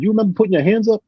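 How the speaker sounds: high, playful retold question; teasing question. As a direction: needle him about swinging. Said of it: "He said".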